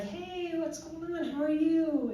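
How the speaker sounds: high, cheery hello to a friend; upbeat phone greeting. Retold or imitated. Greeting a friend at work by phone, upbeat.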